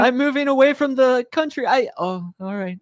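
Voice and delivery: high acted voice, fading at the end; high voice trailing off; awkward exit. Acted awkward exchange continues.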